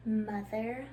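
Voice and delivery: ordinary delivery, nothing marked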